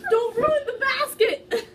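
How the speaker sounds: laughing shout. Real, not acted. Reader yelling at the cat in the basket, laughing shout. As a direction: yell at the cat while laughing.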